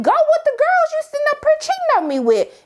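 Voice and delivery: high-pitched hammed-up fury; mock angry retort. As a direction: throw the words back at the cheater. Angela throwing Tony out.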